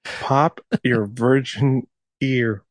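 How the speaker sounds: drawn-out echo, savoring each word; slow mocking repeat. Shown as a demonstration. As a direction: repeat it slowly, amused. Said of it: "repeats friend's line slowly".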